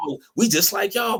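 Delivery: high mock voice of the Italians. Mocking Italians claiming kinship, in a high voice. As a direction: pitch it up, eager to please. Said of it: "The Italians was like".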